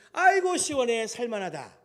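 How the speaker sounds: high, loud sigh of relief in words, easing down at the end; relieved exclamation. Acted. Preacher voicing yesterday's comfort in the shade, loud relief. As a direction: burst out with acted relief. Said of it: "마음이 바뀌어요".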